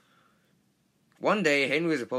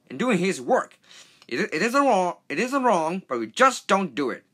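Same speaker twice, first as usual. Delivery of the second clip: higher, louder pompous lecture; pompous engine; 9 semitones higher, 6 dB louder than usual. Acted. Big engine lecturing young Henry, pompous. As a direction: lay down the law like a proud old engine.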